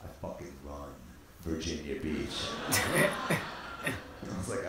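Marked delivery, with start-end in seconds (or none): 0.0-2.5 hushed imitation of a big shot; mock-solemn boast. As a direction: quietly claim to own the town. Acting Stu's solemn boast.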